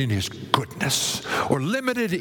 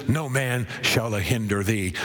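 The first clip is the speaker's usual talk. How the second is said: normal talking voice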